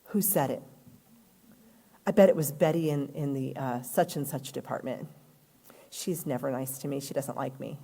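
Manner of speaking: low, hushed muttering, opening in a whisper; worried inner thoughts. Pretend. Upset employee imagines who complained, low suspicious muttering.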